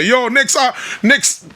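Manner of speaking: mimicked studio boss moving people along; pressuring studio staff. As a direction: hustle the artist out, mock-urgent.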